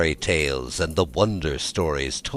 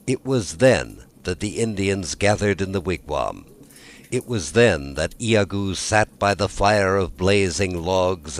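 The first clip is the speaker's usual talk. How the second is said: usual speaking voice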